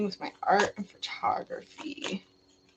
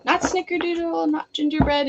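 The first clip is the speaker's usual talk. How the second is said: excited; 7 semitones higher than usual. Real, not acted. Calling out wrong guesses.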